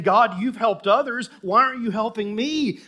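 aggrieved psalmist voiced by a preacher; David's protest. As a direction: demand an answer, loud and high. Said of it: "David is saying".

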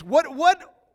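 usual speaking voice

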